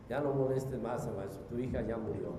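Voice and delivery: low, soft messenger with grave news; subdued bad news. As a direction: break the death news quietly. Voices messenger bringing news of death.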